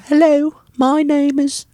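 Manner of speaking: high, drawn-out English accent. Shown as a demonstration. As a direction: greet slowly in a high English voice. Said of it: "that are high".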